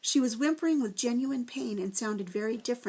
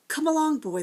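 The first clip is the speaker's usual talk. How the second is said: sharp, higher and louder command; snapping; 7 semitones higher, 6 dB louder than usual. A narrator's reading. The Proc snaps at Tom to hand over the bird, sharp and loud. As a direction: snap at him impatiently. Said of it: "the Proc snapped".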